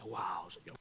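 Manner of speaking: barely whispered wonder; a small, breathy whispered gasp of awe; tearful awe, hushed. Retold or imitated. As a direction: whisper it, overwhelmed and weepy. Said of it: "yo lloraba al lado".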